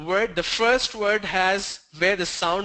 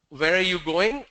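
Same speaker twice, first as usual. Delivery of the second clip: ordinary talk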